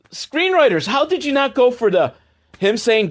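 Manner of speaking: high-pitched incredulous protest; exasperated disbelief. Real, not acted. Reviewer to the screenwriters over a missed joke, exasperated. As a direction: demand an answer, voice climbing.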